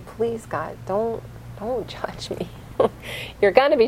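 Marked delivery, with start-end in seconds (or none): 0.0-0.8 soft, breathy prayer under the breath; hushed begging whisper; whispered plea. Acted. Whispered prayer on Rosh Hashanah. 0.9-2.5 soft, breathy prayer under the breath; hushed begging whisper; whispered plea. Acted. Whispered prayer on Rosh Hashanah.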